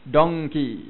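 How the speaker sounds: "Donkey" with a hard English D, loud; "Donkey" said the English way, heavy D. Shown as a demonstration. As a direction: bark out "Donkey" with a crisp English D. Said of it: "Bahasa Inggeris?".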